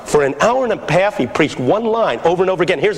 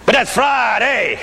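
higher, slow, stretched-out pulpit cry; preaching picking up; 4 semitones higher, slower than usual. Retold or imitated. Old pastor building his sermon, preaching picking up. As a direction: draw it out like a fervent preacher. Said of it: "beginning to pick up now".

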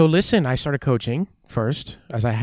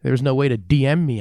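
excited outburst; 4 semitones higher, 8 dB louder than usual. Real, not acted. Laughing rant about pre-social-media days.

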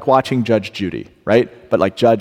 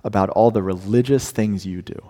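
slow and soft, sincere; earnest gentle plea; 3 semitones lower, 3 dB quieter than usual. Retold or imitated. Preacher voicing God's deeper wish for his people. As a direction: speak it tenderly. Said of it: "God is saying".

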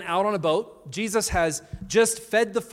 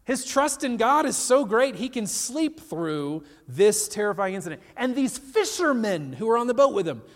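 ordinary talk

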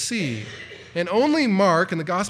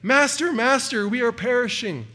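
higher and louder, frantic calling; desperate cry; 7 semitones higher, 4 dB louder than usual. Acted. Disciples waking their master in the storm, frantic cry. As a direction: call out twice, then fall into despair. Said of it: "just desperate cries for help".

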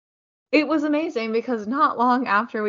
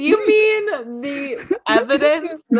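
incredulous; 7 semitones higher, 5 dB louder, slower than usual. Acted. Mock outrage at rumor denial.

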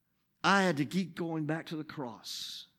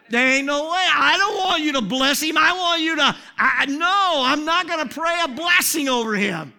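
much higher and louder, near yelling; shouted refusal; 12 semitones higher, 12 dB louder than usual. Retold or imitated. Pastor refusing to bless the man, shouting. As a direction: yell the refusal at God. Refusing God's command, retold.

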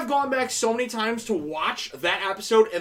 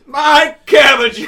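slow, loud wail of despair; anguished shout; 5 semitones higher, 12 dB louder, much slower than usual. Retold or imitated. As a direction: wail it like a ruined merchant. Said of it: "quoting a meme line".

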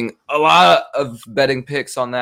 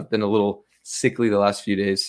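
ordinary talk, nothing marked

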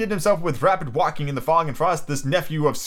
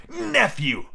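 sudden high-pitched rebuke; stern; 9 semitones higher than usual. A narrator's reading. Uncle rebuking his nephew, stern exclamation. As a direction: cut him off with one stern word. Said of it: "returned the uncle sternly".

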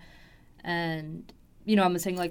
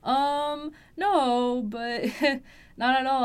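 higher, louder, laughing no; half-laughing correction, pitched up; amused denial; 5 semitones higher, 5 dB louder, much slower than usual. Retold or imitated. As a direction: laugh off the silly question, a notch up in pitch. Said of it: "I was like".

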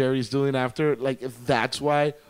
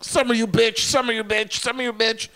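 high-pitched, repeated mock jeer; mimicked taunting; 8 semitones higher, 3 dB louder than usual. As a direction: imitate them ganging up on her in a high chant. Mimics characters taunting Summer repeatedly.

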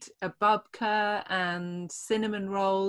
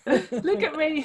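high, louder mock old lady, laughing; old person voice; 9 semitones higher, 6 dB louder, slower than usual. Teasing about old age, high laughing old-lady voice. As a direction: show off like a proud old lady. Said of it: "start telling people how old you are".

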